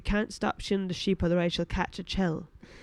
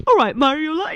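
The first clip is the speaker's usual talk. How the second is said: loud, higher-pitched Paisley impression; [name]'s Paisley accent; 7 semitones higher, 7 dB louder than usual. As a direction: hail a pal in a put-on Paisley accent. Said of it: "think it was almost [name]".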